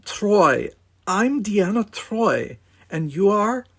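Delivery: high, flustered stammer. A narrator's reading. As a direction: get the words out nervously, high. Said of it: "managed to stammer".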